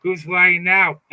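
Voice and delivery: loud taunting ha ha ha; mocking laughter. Taunting the liars, loud mocking laugh. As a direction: gloat loudly at them.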